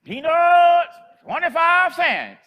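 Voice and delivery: high, loud, drawn-out peddler's cry; loud, slow hawker's call down an empty sidewalk; street vendor's call. Retold or imitated. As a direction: call it out long and high like an old street seller. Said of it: "she called out".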